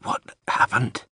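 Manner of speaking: a weak, breathless whisper; barely voiced, dying man's whisper. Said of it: "he whispered".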